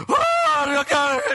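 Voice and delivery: very high, loud mock wail; sudden high-pitched imitation of a yell; imitated wailing yell. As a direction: mimic a woman suddenly crying out. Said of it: "she starts crying and yelling".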